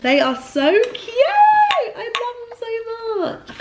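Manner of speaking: high, slow squeal of adoration; gushing. Real, not acted. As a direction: coo over them like a treasure. Gushing over glasses.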